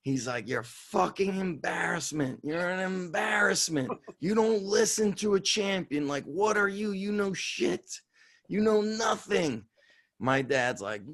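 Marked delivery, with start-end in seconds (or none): 0.5-9.6 higher-pitched imitation of a grandfather's tirade; mock old-world scolding. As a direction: berate him in disgust, without shouting. Grandfather scolding him in the car.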